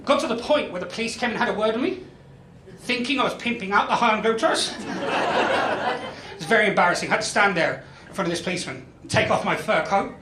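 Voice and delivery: ordinary delivery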